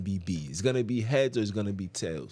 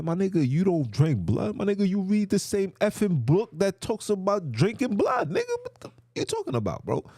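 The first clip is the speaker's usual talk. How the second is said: high-pitched disbelief, climbing as it goes; incredulous comeback; 9 semitones higher than usual. Retold or imitated. Retelling his reply on drinking blood, incredulous. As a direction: build to a high, baffled retort.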